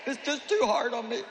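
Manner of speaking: lightly mocking a whining believer; a hint of a self-pitying whine to God; whiny self-pitying prayer. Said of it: "Come on, you know how it sounds".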